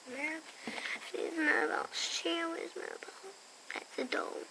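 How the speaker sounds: ordinary delivery, nothing marked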